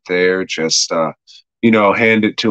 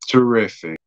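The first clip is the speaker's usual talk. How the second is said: plain reading voice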